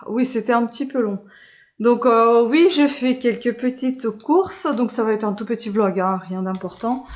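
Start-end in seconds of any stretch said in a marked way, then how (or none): none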